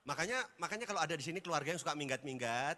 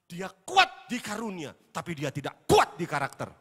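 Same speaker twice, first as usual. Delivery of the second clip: preaching at full force; 14 dB louder than usual. Acted. Preacher thundering at the congregation. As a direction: drive the contrast home to the church.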